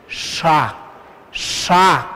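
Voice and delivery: "sha" said alone with a curled-back SH; retroflex SH. Shown as a demonstration. Teacher asking learners to watch the mouth, retroflex SH. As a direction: show "sha" slowly, tongue tip near the palate. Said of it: "Obsérvenme bien".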